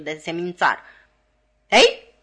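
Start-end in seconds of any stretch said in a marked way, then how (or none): 1.7-2.1 put-on sharp loud cry at the boys; shout. A narrator's reading. Sister yells at Joe and Pip as they enter, loud shout. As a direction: bark it out hard. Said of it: "strigă sora mea".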